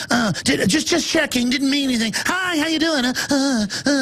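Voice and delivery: high, sheepish mock voice of a caught busybody; groveling creep. Stranger caught out at the park, backing off in a high sheepish voice. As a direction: backpedal nervously, high and fawning. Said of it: "They'll look at you and go".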